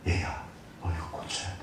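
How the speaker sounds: soft, low whispered correction; God gently correcting his child in a whisper; gentle whisper. Acted.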